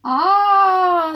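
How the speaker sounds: high, loud admiring ah; admiring sigh. Acted. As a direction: sigh in awe with the crowd. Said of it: "the crowd sighed".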